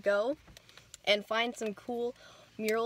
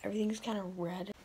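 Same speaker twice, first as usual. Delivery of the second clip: whispering; 5 dB quieter than usual. Real, not acted. Late night whispered vlog.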